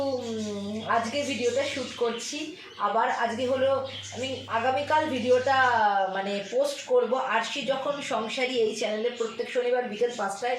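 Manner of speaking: subdued, low-key chat at home; quiet confiding to viewers; hushed talk. Real, not acted. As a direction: keep it low so the house stays calm.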